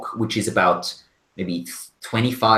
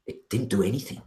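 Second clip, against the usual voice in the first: soft, hushed and shaken; shaken whisper; 5 dB quieter than usual. Acted. Valky tells Urda of the killings, hushed and shaken. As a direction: whisper the horror you just saw. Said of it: "she says".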